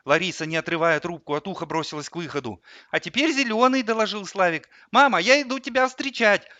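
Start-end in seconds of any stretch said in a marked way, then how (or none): 2.9-3.8 put-on high-pitched little boy; child voice. A narrator's reading. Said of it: "Он говорил в телефон детским голосом". 4.9-6.5 put-on high little-boy voice; child voice. A narrator's reading. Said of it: "Он говорил в телефон детским голосом".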